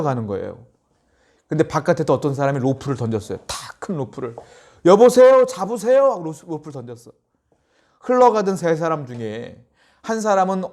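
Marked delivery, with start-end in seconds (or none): 4.9-6.1 loud call to the drowning. Retold or imitated. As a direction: shout it across the river. Rescuer calls out to drowning men.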